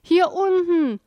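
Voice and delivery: high, loud wail from far below; plaintive, drawn-out cry for help; pitiful call. A narrator's reading. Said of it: "hallte es jämmerlich durch die tiefe Schlucht".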